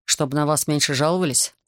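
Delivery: usual speaking voice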